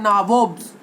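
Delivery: "नाबोब्स" as naa-vobs, rounded "o" and final s; mispronounced nawab. Shown as a demonstration. Teacher answering own question to class, mispronounced "नाबोब्स". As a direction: say the corrupted form "नाबोब्स" firmly. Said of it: "wrong way में पढ़ते हो".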